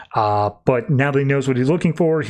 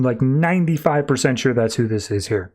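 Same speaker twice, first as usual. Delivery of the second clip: ordinary delivery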